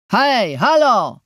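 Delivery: loud drawn-out hail across the counter; roar. A narrator's reading. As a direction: bellow to get the boss's attention. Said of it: "ryknąłem".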